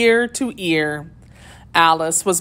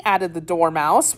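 plain reading voice

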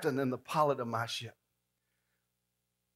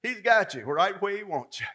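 rising preacher's call, louder at the end; preaching; 4 semitones higher, 6 dB louder than usual. Acted. Preacher rousing the congregation, climbing in pitch. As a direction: preach it up to the punch.

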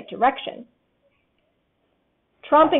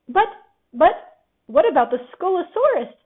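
slightly higher nervous squeak; squeaky; 5 semitones higher than usual. A narrator's reading. Piglet still fearing the monster, nervous squeak. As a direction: squeak it, stammering. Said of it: "squeaked Piglet".

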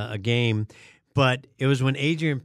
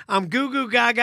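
ordinary talk, nothing marked

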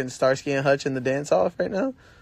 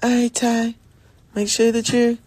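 higher motherly nagging voice; mom voice; 7 semitones higher than usual. Pretend. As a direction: nag like a caring mom. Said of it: "clears throat".

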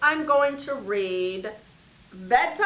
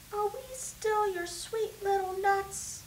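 put-on small child voice; 7 semitones higher, 8 dB quieter than usual. A narrator's reading. Child asks mother tenderly.

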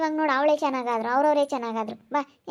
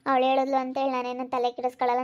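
ordinary delivery

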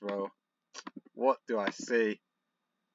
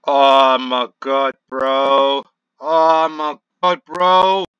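loud, slow, drawn-out disbelief; exasperated shouting; 11 dB louder, much slower than usual. Real, not acted. Gamer watching his match fall apart, exasperated shout. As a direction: wail it out at full volume, stretching each word.

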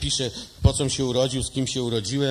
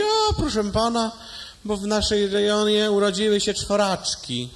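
prim nurse voice; 6 semitones higher than usual. Acted. (wyniosła pielęgniarka, wolno i wyżej)

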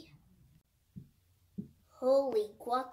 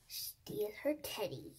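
whisper; 2 semitones lower, 8 dB quieter than usual. Acted. Scheming as the villain.

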